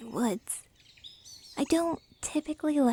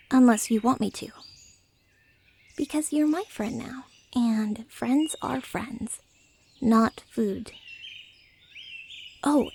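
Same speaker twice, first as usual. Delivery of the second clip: usual speaking voice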